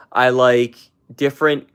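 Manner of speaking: ordinary talk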